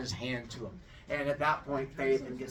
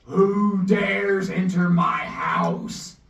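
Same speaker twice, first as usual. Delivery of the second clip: slow, loud godly boom; booming deep voice; 4 semitones higher, 10 dB louder, much slower than usual. Acted. Sun god booming at an intruder, slow and loud. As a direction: thunder it from the throne. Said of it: "he hears a deep voice go".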